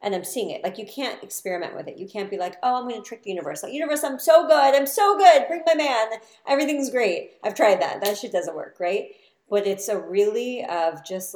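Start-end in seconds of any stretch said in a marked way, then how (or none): 2.6-7.3 mock cheerful. Mocking trying to trick the universe.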